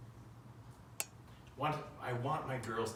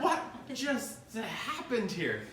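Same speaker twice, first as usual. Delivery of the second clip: high, drawn-out mock disbelief; slow, stunned question played for laughs; baffled; 10 semitones higher, much slower than usual. Dad watches his toddler hit and walk away, comically baffled.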